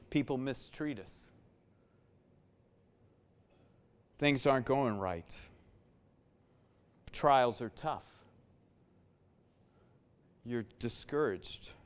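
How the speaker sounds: low, soft and grave; hushed, sombre preaching. Acted. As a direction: preach it quietly, with long pauses between phrases.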